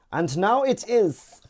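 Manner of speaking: put-on loud, high, slow German accent; hammed-up German accent, drawn out and high. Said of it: "do a German accent like that".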